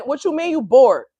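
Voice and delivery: scolding grandma, high and loud; sassy old grandma fussing. Retold or imitated. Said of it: "I be hearing my grandma voice".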